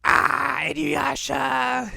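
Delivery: high, drawn-out cartoon cry; mock wail. Shippo mourns his friend, hammed-up high wail. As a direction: wail the name in fake grief.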